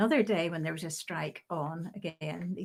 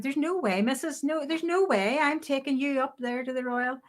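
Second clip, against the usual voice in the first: imitating a Belfast boss, pitched up; best Belfast accent; 5 semitones higher than usual. Boss turning down the nurse, in Belfast accent. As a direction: refuse firmly in thick Belfast accent. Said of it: "That's my best Belfast accent".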